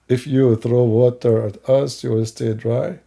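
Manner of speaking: slow, low, measured questioning; slow incredulous repeat. Acted. Host checking the opponent's claim, slow and deliberate.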